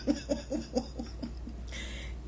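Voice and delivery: high airy laugh under the breath; breathy laugh. Real, not acted. Laughing at women chasing men, airy laugh. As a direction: let a silent high laugh escape.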